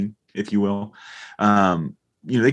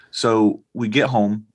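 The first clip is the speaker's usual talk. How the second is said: ordinary talk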